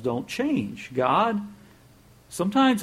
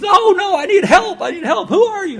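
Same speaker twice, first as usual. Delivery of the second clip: panicked pleading; 12 dB louder than usual. Retold or imitated. Jack begs God for help.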